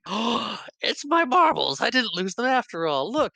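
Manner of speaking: squealed, hammed-up joy; overjoyed old character. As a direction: imitate someone thrilled to find a lost treasure. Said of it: "He's so happy".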